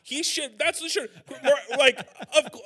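high, loud laughing words. Real, not acted. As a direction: crack up mid-sentence. Laughing agreement.

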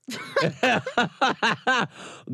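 big shrieking laugh, head thrown back; loud high laugh. Real, not acted. Friend cracking up at his own nude-photo joke, loud high laugh. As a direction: let a high laugh burst out.

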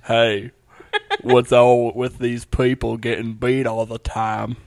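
slow down and play Doctor Phil; slow loud Doctor Phil; Doctor Phil impression. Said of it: "more like a Doctor Phil".

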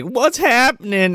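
high, drawn-out character voice; Bam. Retold or imitated. Retelling Bam in the back seat, high slow questioning. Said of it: "She's like".

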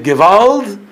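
Gewalt shouted high and loud; emphatic shout. Shown as a demonstration. Teacher shouting Gewalt to his listeners, emphatic. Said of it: "and emphasizing it".

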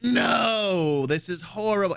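high-pitched mock cry of horror; high, horrified wail held at talking volume; retold scream. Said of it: "I'm screaming at my chair".

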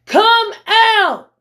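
high, loud call to the dead; loud commanding yell. Acted. Preacher as Jesus calling Lazarus from the tomb, loud command. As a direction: cry it out as a ringing command. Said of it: "he yells with a loud voice".

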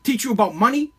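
ordinary delivery

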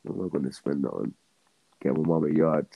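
normal talking voice

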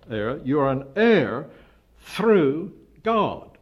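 "God" punched high and loud; emphatic. Shown as a demonstration. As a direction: land the last word "God" with full weight. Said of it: "Very emphatic".

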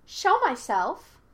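put-on high, loud, drawn-out child voice; childlike voice. A narrator's reading. Hidden spirit answers Yue's challenge, high-pitched and childlike. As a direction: echo it like a teasing child. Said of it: "high-pitched like a child".

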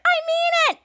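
high-pitched insistent cry; acted angry warning. Girl warning the boy in a tussle, mock angry cry.

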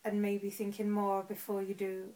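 careful, halting Southern Irish lilt; Southern Irish accent. Shown as a demonstration. Host demonstrating for a friend, careful Southern Irish accent. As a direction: sound each vowel in a Southern Irish accent. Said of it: "Go on then, Southern Irish".

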